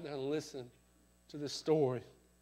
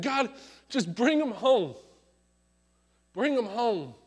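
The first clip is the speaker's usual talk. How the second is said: pleading cry, louder and higher; desperate prayer; 5 semitones higher, 8 dB louder than usual. Retold or imitated. Preacher voicing the wife's plea for her husband, crying out. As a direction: beg God to bring him home.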